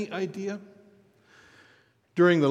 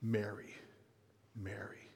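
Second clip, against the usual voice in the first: tender calling; 8 semitones lower, 17 dB quieter than usual. A narrator's reading. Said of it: "said Mary's name".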